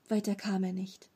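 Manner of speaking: ordinary delivery, nothing marked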